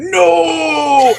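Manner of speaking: hammed-up cry of defeat; villain's dying scream. A narrator's reading. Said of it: "the Space Master screamed".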